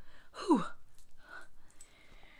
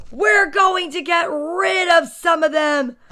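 slow, loud, raised-pitch announcement; stern yelling mom; 5 semitones higher, 9 dB louder, slower than usual. A narrator's reading. Mom telling son toys must go, loud and stern. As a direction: lay down the law at full volume.

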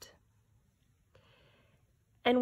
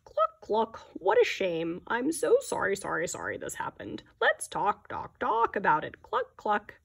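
fussy chatty hen voice, louder; clucking chicken; 4 dB louder than usual. Acted. Teacher voicing the chicken to Taylor, fussy and clucking.

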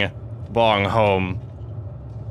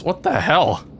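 usual speaking voice

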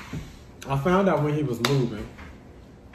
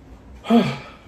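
heavy fed-up sigh; loud exasperated sigh; 6 semitones higher, 6 dB louder than usual. Real, not acted. After ranting about her ex, a loud weary sigh. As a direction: let out a big loud sigh.

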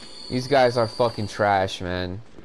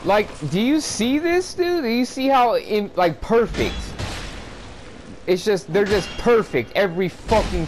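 incredulous whine; 8 semitones higher than usual. Real, not acted. Gamer to his viewers about flawless enemies, high incredulous whine. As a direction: pitch it way up in outraged disbelief.

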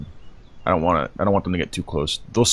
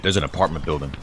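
ordinary talk, nothing marked

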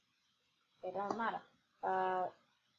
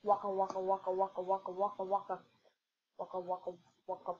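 Pac-Man sound; 3 semitones lower, faster than usual. Pretend. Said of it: "I'm gonna be like Pac-Man".

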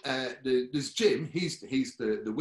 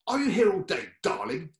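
put-on much higher, louder posh old lady; posh Molly; 6 semitones higher, 8 dB louder than usual. [name] imitates posh Molly, higher and louder. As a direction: trill it like a grand old dame. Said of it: "all she ever said was".

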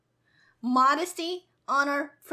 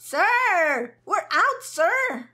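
higher and louder, calling out triumphantly; excited shout; 5 semitones higher, 6 dB louder, much slower than usual. Real, not acted. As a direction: yell it like you just broke free. Escaping the maze, calling out.

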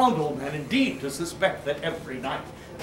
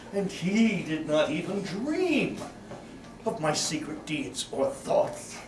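ordinary delivery, nothing marked

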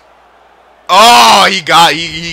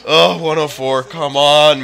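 ordinary talk

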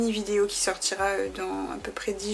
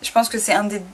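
ordinary talk, nothing marked

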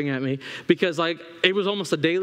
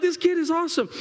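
high, thrilled praise; high-pitched glee; delighted; 9 semitones higher, faster than usual. Retold or imitated. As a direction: re-enact a proud, excited dad. Retold delight at fearless son.

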